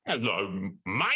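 hammed-up fake British accent; mock a clumsy British accent; bad British accent. Mocking other auditioners' bad British accent, hammed up. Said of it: "did not have good British accents".

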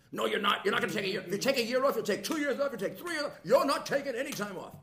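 loud, high, insistent mock shouting; imitating strict father. Father forbids son's year off, loud mock shout. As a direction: bark the refusal like an outraged dad.

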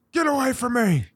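high mock snarl of an irritated New Yorker; agitated New Yorker. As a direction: shove people off like a cranky city monster. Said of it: "Kind of acting like a monster".

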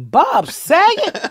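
high, drawn-out disbelieving question; astonished. Retold or imitated. Comedian mimics her family finding a star at the door, astonished.

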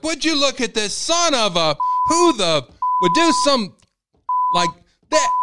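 very high, loud, slow cursing rant; dad ranting. Retold or imitated. Father ranting at the meat guy, loud and high. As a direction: blast the butcher's prices. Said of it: "my dad was like".

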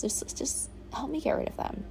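breathy begging whisper; whispered plea. Retold or imitated. Begging the universe to take her burdens, whispered. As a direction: whisper it like a desperate prayer.

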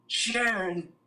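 high, mumbling rock-star [name] voice. Retold or imitated. [name] complaining to Sharon about the limo, mock grumble. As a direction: grumble it like [name] at his wife. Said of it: "the first thing [name] does is".

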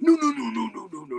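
high, loud, frantic string of no's; panicked no. Retold or imitated. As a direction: stop him in a panic.

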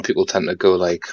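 ordinary talk

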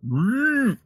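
high trilled buzz; buzzing sound. Acted. A sending stone going off, high imitated buzz. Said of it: "and it buzzes".

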